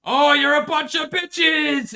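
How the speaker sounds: high, loud, slow jeering yell; hammed-up soldier taunt; mock taunting shout. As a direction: sneer it at the cowards behind the walls. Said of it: "Modenese were like".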